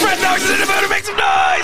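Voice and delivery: pumped crowd call; hype man. Acted. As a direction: announce him like a hype man.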